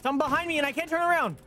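rapid, very high-pitched panic; panicked cry. Real, not acted. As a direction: blurt it out fast and high. Panics as something attacks from behind.

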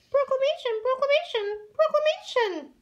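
very high worried repeating; nervous squeak. Acted. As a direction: fret over the word, panicking. Wilbur frets over the word.

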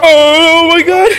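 high startled scream; raging gamer. Real, not acted. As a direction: shriek in sudden fright. Jump scare death.

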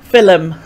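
"film" said as two syllables, fil-um; "film" with an extra vowel: fil-um; sunderland accent. Shown as a demonstration. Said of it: "more of a pronunciation rather than a".

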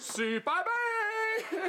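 very slow, high drawn-out wail; strained wail. Acted. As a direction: stretch it into a high-pitched wail. Caught after crying out, answers strained. (longue plainte aiguë)

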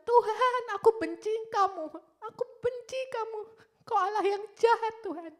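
high, loud anguished outcry; tearful anger at God. Retold or imitated. Girl with cancer telling God she hates Him, anguished anger. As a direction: cry out in bitter protest, re-enacted. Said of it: "saya menangis".

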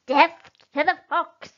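loud, high battle cry; shouted. Acted. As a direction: roar it like a charge. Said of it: "shouted Bunce".

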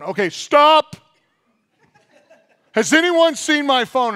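loud, high call to the whole family; exasperated shout at the family; mock frantic yell. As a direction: stop everyone, hammed-up panic. Frantic parent searching for phone.

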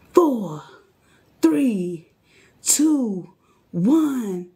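countdown. Real, not acted. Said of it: "Get ready".

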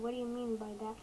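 ordinary talk, nothing marked

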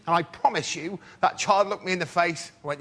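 ordinary talk, nothing marked

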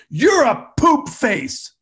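put-on high, slow kid taunt; bratty kid. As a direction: drag it out like a bratty kindergartner. Kid insulting, voiced.

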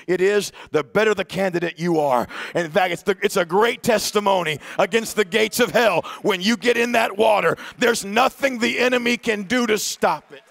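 fiery pulpit preaching; preaching at full force. Acted. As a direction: drive it home to the congregation like a revival call. Preaching climax on baptism.

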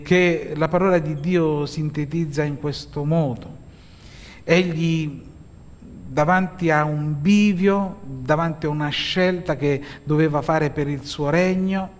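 normal talking voice

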